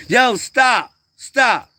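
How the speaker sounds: high, loud scolding bursts; punchy, repeated holler; shouted command. Real, not acted. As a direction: yell to break up the scuffle. Calling out to children.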